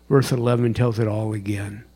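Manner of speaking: normal talking voice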